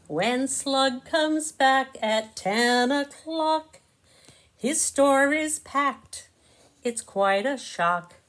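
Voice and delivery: slow, lilting storybook narration; reading to children. A narrator's reading. Narrator reading Bug in a Rug to kids, slow and sing-song. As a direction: savour each rhyme for little listeners.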